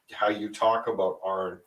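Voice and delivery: ordinary delivery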